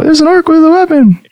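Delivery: a hint of Brimley's shout; lightly voiced alarm. DM retells Brimley's call, lightly voiced. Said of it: "you hear Brimley go".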